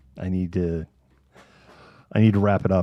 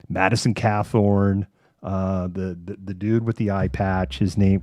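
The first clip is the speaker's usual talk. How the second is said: ordinary talk, nothing marked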